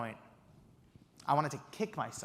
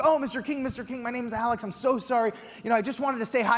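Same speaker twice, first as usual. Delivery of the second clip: high, breathless apologetic babble; a fan caught out, nervous and high; flustered; 8 semitones higher, 3 dB louder than usual. Retold or imitated. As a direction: gush out an awkward apology. Flustered apology to startled celebrity.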